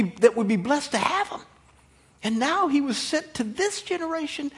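high, swelling preacher's oratory; impassioned preaching. Real, not acted. Preacher tells what the Messiah was to do, fervently.

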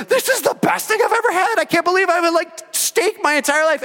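high, loud mock rapture; delighted. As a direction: gush over the first bite. Acted delight at first steak.

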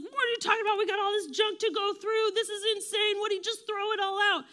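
high, loud mock rant; exasperated family. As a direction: rant like relatives at the end of their rope. Acts out exasperated family ranting.

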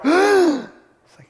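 sharp high gasp of shock. Retold or imitated. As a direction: gasp like a scandalized crowd.

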